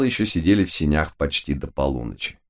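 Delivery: ordinary delivery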